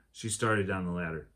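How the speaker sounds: plain reading voice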